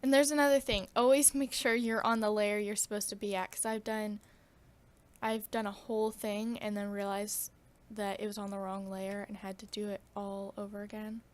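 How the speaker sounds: usual speaking voice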